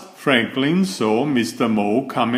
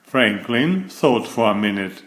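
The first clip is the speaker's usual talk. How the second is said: usual speaking voice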